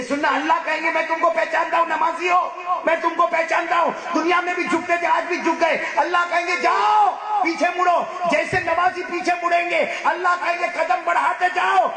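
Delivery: high shouted sermon; preaching at full force. Acted. Preacher describing Judgement Day to congregation, shouting. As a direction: cry it out over the whole crowd.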